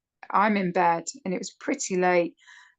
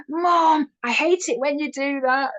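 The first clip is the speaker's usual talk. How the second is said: whiny daughter; 7 semitones higher than usual. Retold or imitated. Daughter shouting at mom over the spooky trick, exasperated whine. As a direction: cry it out like a fed-up kid caught off guard. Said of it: "And she went".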